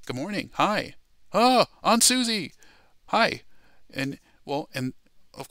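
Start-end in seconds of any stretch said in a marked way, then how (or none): 0.1-3.4 mock delighted, high-pitched hello; playful surprised greeting. Imagined surprise visit to relatives.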